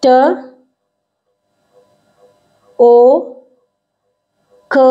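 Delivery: टोक as ट, a loud long ओ, then क; stretched phonics ओ in टोक; sounding out टोक. Shown as a demonstration. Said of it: "अब next देखिए टोक".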